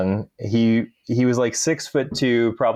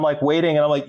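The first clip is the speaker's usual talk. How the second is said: ordinary delivery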